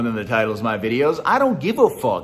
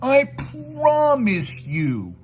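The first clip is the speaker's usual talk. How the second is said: much higher, very slow mock plea; mocking fake promise; 9 semitones higher, much slower than usual. Mocking his rival's fake promise, high and very slow. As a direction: stretch it out like a bad liar. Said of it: "they're lying".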